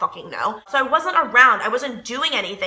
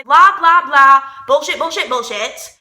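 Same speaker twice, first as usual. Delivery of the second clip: mocking blah blah; 3 semitones higher, 6 dB louder than usual. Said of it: "My standards chair was like".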